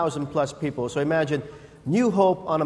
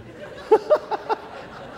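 airy laugh. Real, not acted. Laughing at own joke.

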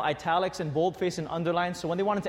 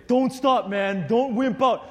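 higher, loud prodding; pushy friend; 6 semitones higher, 7 dB louder, slower than usual. Retold or imitated. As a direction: goad him on. Friend pushing.

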